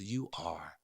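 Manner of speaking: a mother's near-whisper thanks to her son's friend; his mom's soft praise. Retold or imitated. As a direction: say it low like a moved mother. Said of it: "She goes".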